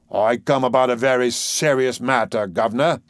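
magisterial Cockney dustman; weighty announcement in a dustman's voice; solemn dustman. Acted. Doolittle the dustman's voice.

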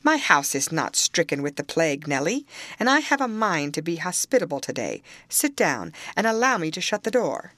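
ordinary delivery

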